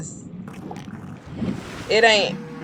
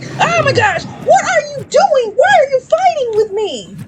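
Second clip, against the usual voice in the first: much higher mock whiny voice; mocking quote. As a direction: imitate whiny friends acting like the victim. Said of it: "These people are like".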